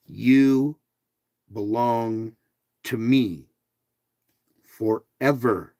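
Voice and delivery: each word set apart, slow; slow and deliberate. Pretend. God telling the listener slowly and deliberately.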